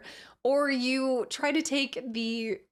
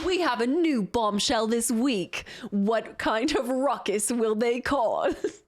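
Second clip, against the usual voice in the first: reality show host voice. Pretend.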